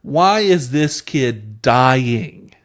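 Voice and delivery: drawn-out exasperated question; slow exasperated. Real, not acted. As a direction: drag it out in disbelief. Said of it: "exasperated slow question".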